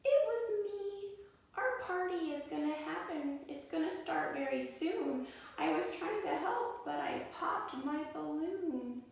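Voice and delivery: scared little hare voice; small shaky voice. Acted. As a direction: squeak it out nervously as the hare. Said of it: "in a small, shaky voice".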